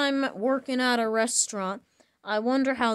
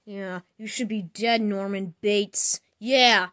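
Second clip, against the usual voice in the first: ordinary talk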